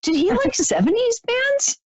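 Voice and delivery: high, mock-disbelieving; incredulous. Psychic teasing the late brother over his bands, high and incredulous. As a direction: tease him like you can't believe his taste. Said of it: "I'm like".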